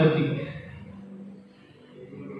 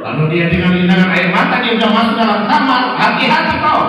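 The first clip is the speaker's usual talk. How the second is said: louder, higher, laughing warning on hati-hati kau; 7 semitones higher, 6 dB louder than usual. Shown as a demonstration. Preacher warning husbands again, louder and higher. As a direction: repeat it with a laugh and rising force. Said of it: "repeated warning".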